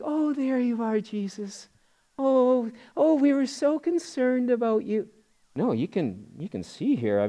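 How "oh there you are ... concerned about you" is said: high, soft, cooing relief; mock-tender parents. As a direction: gush gently, an overly sweet imitation of relieved parents. Imagined too-sweet parents finding lost son.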